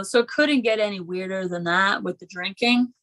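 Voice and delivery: normal talking voice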